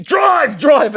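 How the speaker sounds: high loud yell; shouting. Retold or imitated. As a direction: yell it at the rider. Yelling at pizza delivery guy.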